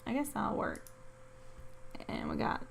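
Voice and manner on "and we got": whisper. Real, not acted. Unvoiced whisper.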